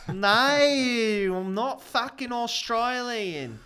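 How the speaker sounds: mock English accent. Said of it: "You almost got some Australian in there".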